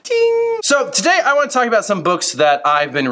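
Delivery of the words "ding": high ding sound effect. Acted.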